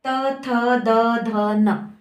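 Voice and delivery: त थ द ध न with tongue touching the teeth; dental demo. Shown as a demonstration. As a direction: sound out त to न evenly, one by one. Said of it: "जीभ दांत को टच करके". (त वर्ग का दंत्य उच्चारण)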